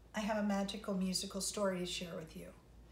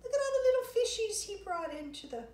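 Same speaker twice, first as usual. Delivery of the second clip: high, fast, louder excited pointing; 12 semitones higher, 6 dB louder, much faster than usual. Real, not acted. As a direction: gush over the picture quickly.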